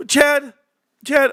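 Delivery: raising the voice to get Chad's attention; calling out. Real, not acted. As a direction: call Chad out of the chat. Calling out to a chatter.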